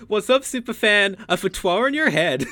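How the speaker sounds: high, put-on streamer greeting; mock catchphrase. Echoing the catchphrase at the target, hammed-up. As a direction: parody shout-out turned mock threat.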